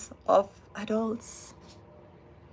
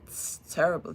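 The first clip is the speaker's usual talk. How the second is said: ordinary talk, nothing marked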